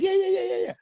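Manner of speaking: high bouncy string of yeahs; mock eager yeahs. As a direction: imitate his cocky eagerness. Friend bragging about rental car.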